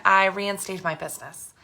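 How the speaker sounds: ordinary talk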